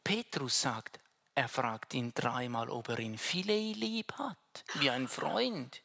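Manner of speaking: usual speaking voice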